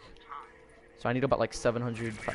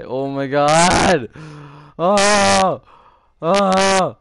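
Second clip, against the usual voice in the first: long, loud groaning outcry; exasperated yell; 5 semitones higher, 12 dB louder, much slower than usual. Real, not acted. Player venting about this kid, exasperated yell. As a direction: draw it out into loud howls. Venting before yelling at teammate.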